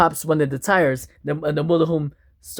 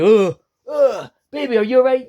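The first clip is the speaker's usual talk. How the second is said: high, loud, panicked voice; scared wife; 8 semitones higher, 3 dB louder than usual. Acted. Wife jolted by speeding motorhome, frightened shout. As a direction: cry out to your husband in fear. Shaken wife in runaway vehicle.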